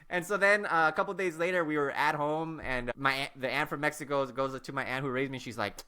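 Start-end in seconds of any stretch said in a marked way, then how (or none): none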